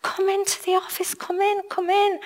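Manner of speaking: high, soft, quick welcoming voice; kind lady inviting. Retold or imitated. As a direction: play the helpful official ushering her in. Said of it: "She said".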